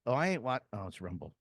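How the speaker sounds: ordinary delivery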